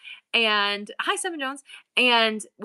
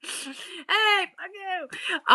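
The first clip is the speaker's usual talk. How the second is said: mock retort; 9 semitones higher, much slower than usual.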